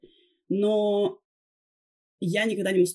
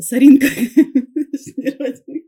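laughing; 6 semitones higher, 12 dB louder than usual. Real, not acted. Joking about freckle.

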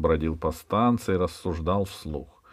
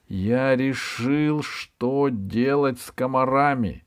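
very slow, higher and deliberate; slowly; 6 semitones higher, slower than usual. A narrator's reading. Пашка revealing his decision on the mosquitoes, slowly. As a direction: announce it slowly, weighing each word. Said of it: "ответил Пашка медленно".

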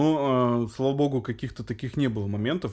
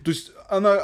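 normal talking voice

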